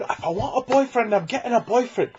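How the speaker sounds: put-on high, loud whiny girl; little girl. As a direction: beg dad like a child. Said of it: "dad and his little girl".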